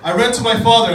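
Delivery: usual speaking voice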